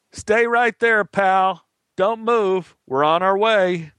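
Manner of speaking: high-pitched mock yell, little added volume; yelled order. Cop tells stranded man to wait, yelling. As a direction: call out instructions across a distance. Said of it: "The cop stared at me and yelled".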